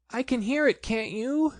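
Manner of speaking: high, nervous small voice; whisper-worried, hoping to be reassured; anxious Piglet. A narrator's reading. Piglet, creeping near the trap, asks Christopher Robin anxiously. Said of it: "said Piglet anxiously".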